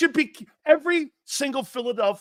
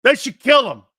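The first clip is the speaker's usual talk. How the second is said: higher, louder emphatic repeat; loud rant; 6 semitones higher, 10 dB louder than usual. Real, not acted. Host repeating to co-host that media should attack Parsons, louder rant. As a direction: hammer the line home.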